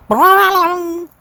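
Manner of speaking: high, twangy mock piano clang; ping it out like struck keys. A narrator's reading. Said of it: "went the piano".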